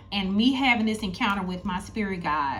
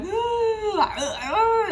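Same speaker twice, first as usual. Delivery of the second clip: mock crying groans; 14 semitones higher, slower than usual. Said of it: "I was crying".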